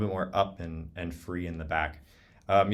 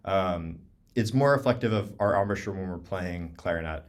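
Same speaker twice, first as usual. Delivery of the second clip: ordinary delivery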